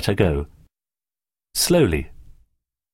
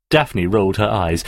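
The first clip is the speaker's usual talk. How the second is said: usual speaking voice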